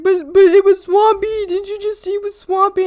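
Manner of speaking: high, loud whiny brother; imitating brother. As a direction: whine like a wronged little brother. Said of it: "My brother's like".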